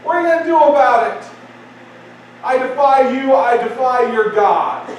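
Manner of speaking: high, forceful jeering dare; defiant challenge hurled out; mocking Goliath. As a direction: taunt a whole cowering army. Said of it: "he mocked them and their God".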